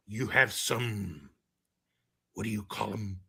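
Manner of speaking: coach voice. Retold or imitated. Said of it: "he looks at me and he's like".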